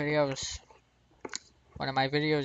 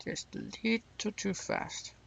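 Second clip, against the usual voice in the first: "little" high, slow and softer; drawn-out understatement; 5 semitones higher, 7 dB quieter than usual. Real, not acted. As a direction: stretch "little" softly on a raised pitch. Repeats the wry understatement.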